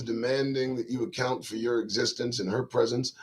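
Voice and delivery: ordinary talk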